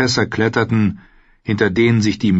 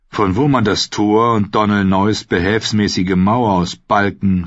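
plain reading voice

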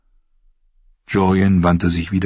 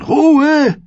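very high, loud command; yelling at him; 6 dB louder than usual. A narrator's reading. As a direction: scream for silence. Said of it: "schrie Bran ihn an". (lautes, hohes Anschreien)

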